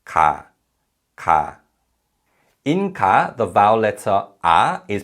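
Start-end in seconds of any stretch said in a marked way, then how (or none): 0.0-1.6 "ka" said clearly, separate and even; teacher modelling "ka" for repetition; model syllable ka. Shown as a demonstration. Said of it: "this syllable is read".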